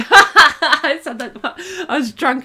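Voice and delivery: says it through a burst of laughter; laughing out the words at her own confession. Real, not acted. Laughing at her own drunk confession to the viewers, through laughter.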